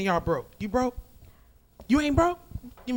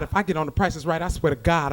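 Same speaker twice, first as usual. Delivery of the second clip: normal talking voice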